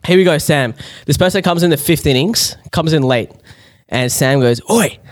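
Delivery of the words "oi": high, heckling mock tirade; going off. Imitating drunk Sam heckling a latecomer, aggressive. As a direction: pick a fight from the stands. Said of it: "Just going off".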